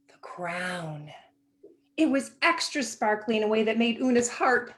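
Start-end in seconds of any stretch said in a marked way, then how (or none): 0.1-1.1 breathy reveal, almost no voice; hushed whisper. A narrator's reading. Narrator whispering to children about the hidden crown.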